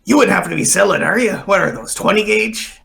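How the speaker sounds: pirate. Acted. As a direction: sound out a seller as a curious pirate. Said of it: "a couple of pirates who come in behind you".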